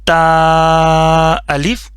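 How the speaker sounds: drawn-out syllable reading, slow and clear; slow teacher demo. Shown as a demonstration. Teacher reciting the letter for students, slow and drawn out. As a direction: model the letter name slowly for the class. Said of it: "اچھا، اس کے بعد ہو گیا".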